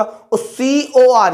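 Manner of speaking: each letter of सीओओओएच stretched very slowly; drawn-out dictation. Shown as a demonstration. Teacher dictating the formula to students, very slowly drawn out. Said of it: "teacher drawing out formula letters".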